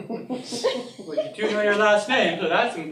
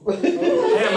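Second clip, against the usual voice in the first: excited; 12 semitones higher, 7 dB louder than usual. Acted. Sees Pam, thrilled.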